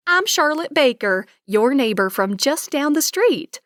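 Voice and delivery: lightly voiced thick Southern accent; friendly Texas Southern lilt, lightly done. Neighbor introducing herself, light Southern accent. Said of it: "with a very thick Southern accent".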